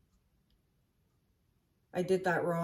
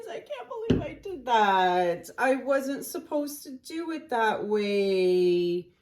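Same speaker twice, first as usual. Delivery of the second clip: annoyed at myself; 4 semitones higher, slower than usual. Real, not acted. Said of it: "I'm so angry at myself".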